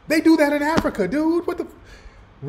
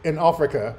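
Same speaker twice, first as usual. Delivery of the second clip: lower, hammed-up British imitation; mock British accent; 7 semitones lower, 3 dB quieter than usual. Reactor mocking the woman in his video, fake British accent. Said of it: "got a British accent talking about".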